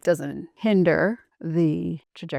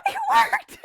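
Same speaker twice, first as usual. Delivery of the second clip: thrilled shout. Real, not acted. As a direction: shout it the moment it works. Balloon rocket succeeds.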